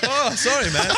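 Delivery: high-pitched put-on sorry; mock apologetic. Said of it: "the sort of apologetic".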